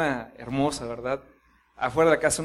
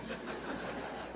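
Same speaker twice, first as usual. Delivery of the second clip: soft laugh; 17 dB quieter than usual. Real, not acted. Laughs at his own thought.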